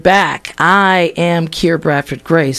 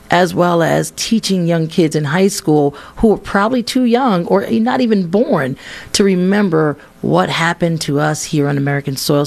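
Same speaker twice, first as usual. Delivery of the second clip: ordinary delivery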